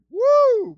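high loud woo; excited whoop. Real, not acted. Preacher whooping about the vineyard. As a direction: whoop with joy.

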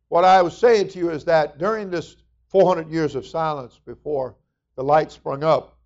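ordinary talk, nothing marked

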